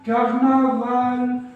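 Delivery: loud, drawn-out "كارنفال" with a clear final L; final L sounded. Shown as a demonstration. Teacher modelling for pupils, "كارنفال" stretched with final L sounded. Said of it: "يبقى الآخر دايمًا بتتنطق في الآخر".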